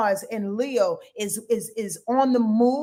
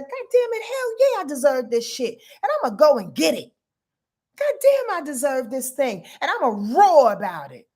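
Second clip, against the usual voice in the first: boastful; 3 semitones higher than usual. Acted. Voicing us waking up to what we deserve, boastful. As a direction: crow it with swaggering, roaring entitlement. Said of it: "here comes boastful fucking Mars and Leo".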